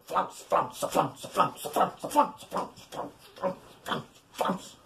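breathy whispered chant; whispered flounce. Shown as a demonstration. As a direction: whisper it to the rhythm. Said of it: "let me show you".

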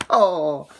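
wheezing, high-pitched laughter fading out; breathless high laugh. Real, not acted. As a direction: laugh until you can't breathe. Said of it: "I'm laughing so fucking much".

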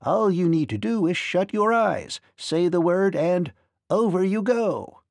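high, lilting little man's voice; tiny ferryman. A narrator's reading. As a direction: instruct her as a tiny magical ferryman. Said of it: "he answered with a laugh".

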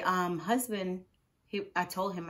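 normal talking voice

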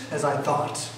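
ordinary delivery

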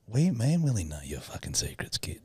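whispered threat, all breath; conspiratorial whisper. Pretend. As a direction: hiss the secret like a spy. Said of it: "unvoiced whisper".

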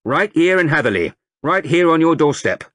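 loud, high recruiting sergeant rousing a crowd; sergeant major. A narrator's reading. Sergeant major rousing the market crowd, booming. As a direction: bellow it to the whole square like a drill sergeant.

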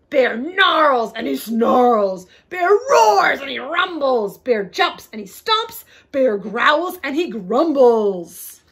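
loud, rumbling storybook drama; big booming read with drawn-out roars; growly, roaring narration. A narrator's reading. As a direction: narrate the bear's rage at full volume. Narrator dramatizes the angry bear waking.

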